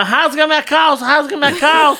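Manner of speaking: mock-frantic high yelling; retold panicked yell. Trapped victim yelling to be cut loose, mock frantic yell. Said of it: "comecei a gritar".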